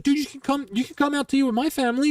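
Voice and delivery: imitating buddy. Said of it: "my buddy's like".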